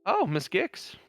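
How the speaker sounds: high-pitched eager coroner; helpful coroner. Acted. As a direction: pipe up helpfully as the old coroner. Coroner recommends the librarian.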